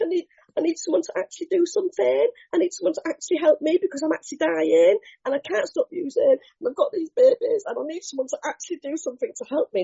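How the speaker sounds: high, fast, frantic pleading; desperate plea. Retold or imitated. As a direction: rush it out in desperation. Desperate plea for real help.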